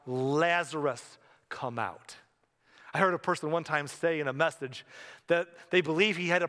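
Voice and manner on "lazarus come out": ordinary delivery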